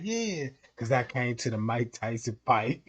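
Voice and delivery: low, quiet put-on Trump. Comedian voicing Trump bragging about the fight, low and hushed. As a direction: sink the voice way down and hush it.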